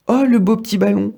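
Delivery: quick, high, delighted exclamation; a boy spotting a ball to kick; excited kid. Acted. The kid exclaims at Trognon, quick and delighted. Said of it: "s'écrie le gamin".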